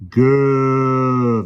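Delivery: loud drawn-out fierce shout. A narrator's reading. Policeman shouts fiercely at the lion, loud long shout. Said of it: "the policeman shouted fiercely".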